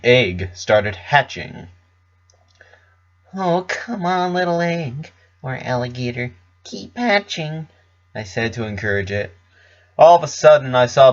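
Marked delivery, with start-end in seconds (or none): 3.4-7.7 higher, softer urging of the egg; coaxing Pinkie voice. Acted. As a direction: gently cheer the hatching egg on.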